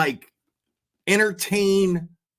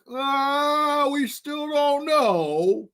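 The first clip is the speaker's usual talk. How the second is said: much higher, slow drawn-out mock innocence; mock Vinny; 9 semitones higher, much slower than usual. Streamer mocks the host feigning doubt, high and slow. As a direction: stretch it out like a clueless pundit. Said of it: "you're talking like".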